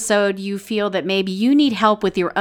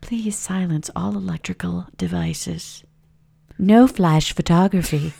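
museum guide announcement; 2 semitones lower, 6 dB quieter than usual. Pretend. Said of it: "Mom turns into a museum guide".

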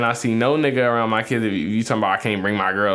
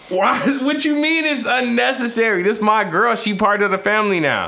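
high-pitched, heated defense; indignant protest; 10 semitones higher, 3 dB louder than usual. Real, not acted. Man insisting his girlfriend belongs at the family event, indignant. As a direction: protest with pitch raised all the way through.

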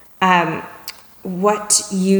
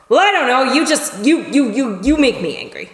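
higher, stammering mock complaint; flustered blame, tripping over the "you"; acted upset; 5 semitones higher than usual. Upset person answers the calm question, acted upset. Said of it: "And they might go".

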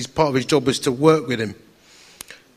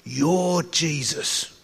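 slow solemn quote; 5 dB quieter, much slower than usual. Retold or imitated. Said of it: "his exact words".

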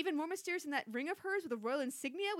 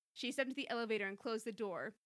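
ordinary delivery

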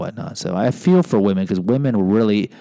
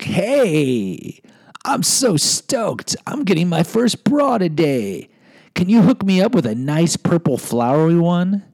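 mock gushing; 8 semitones higher, slower than usual. Mock gushing boy to clerk.

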